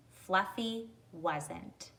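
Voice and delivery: usual speaking voice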